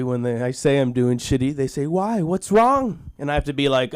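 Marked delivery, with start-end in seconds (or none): 1.9-3.1 high, rising worried question; mock concern. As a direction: imitate someone alarmed on your behalf. Voicing concerned people asking.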